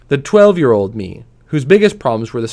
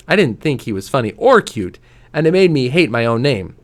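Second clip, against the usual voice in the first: plain reading voice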